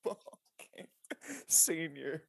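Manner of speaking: whispered joke. Real, not acted. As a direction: whisper it with a giggle. Joking whisper aside.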